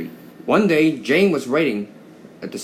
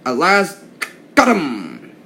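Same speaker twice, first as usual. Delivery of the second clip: triumphant; 4 semitones higher, 6 dB louder, slower than usual. Acted. Inspector catches runaway engine.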